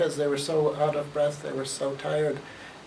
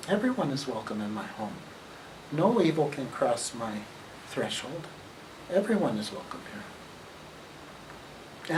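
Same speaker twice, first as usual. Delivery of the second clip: calm grandfather; 2 semitones lower, 5 dB quieter than usual. Acted. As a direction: settle the frightened cubs gently. Wise grandfather calming the cubs.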